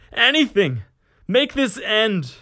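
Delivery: high, loud agonized scream; full-throated begging scream; screamed plea. A narrator's reading. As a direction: scream desperately for mercy. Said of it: "He screamed now".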